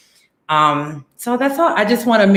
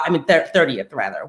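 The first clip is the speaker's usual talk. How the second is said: ordinary talk, nothing marked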